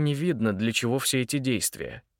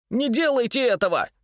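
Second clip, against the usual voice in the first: much higher, louder desperate cry; shouting; 11 semitones higher, 4 dB louder than usual. Acted. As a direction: shout to stop her. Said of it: "крикнул он". (отчаянный крик)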